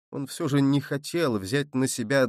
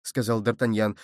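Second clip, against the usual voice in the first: ordinary delivery, nothing marked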